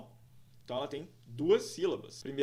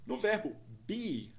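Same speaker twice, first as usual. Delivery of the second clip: ordinary delivery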